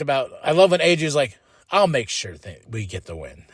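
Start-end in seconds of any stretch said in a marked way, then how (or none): 1.7-3.4 voicing AJ. AJ voiced in recap.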